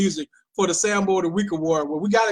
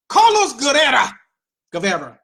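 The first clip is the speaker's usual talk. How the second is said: hammed-up Spanish-accented name; playful Spanish accent; 2 semitones higher, 4 dB louder than usual. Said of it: "I'm not gonna do the accent".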